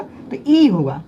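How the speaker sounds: ई held twice as long, slow. Shown as a demonstration. Teacher showing long vowel to students. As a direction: drag out ई to double length. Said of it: "उससे दुगना समय लेगा".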